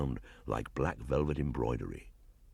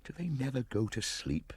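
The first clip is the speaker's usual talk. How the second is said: higher, softer musing; to himself; 9 semitones higher, 5 dB quieter than usual. A narrator's reading. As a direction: mutter under breath. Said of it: "he said to himself".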